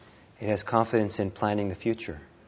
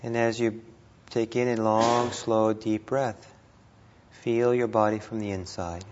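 guided meditation; 3 dB quieter than usual. Real, not acted. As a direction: ease them gently through each breath, unhurried.

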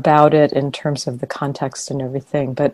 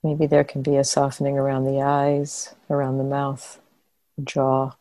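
calm meditation guidance with long pauses between phrases; guided meditation; 4 dB quieter than usual. Real, not acted. Teacher guiding meditators to soften and relax the body, guided meditation. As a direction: guide them gently into relaxation, leaving long silences.

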